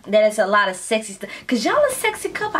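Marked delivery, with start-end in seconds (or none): 1.5-2.6 high, fast, giddy praise; excited gushing. Real, not acted. Reacting to a sexy couple, the reacter gushes high and fast. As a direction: gush at the couple, pitch way up.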